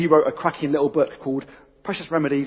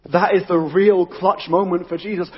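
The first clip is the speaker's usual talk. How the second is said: normal talking voice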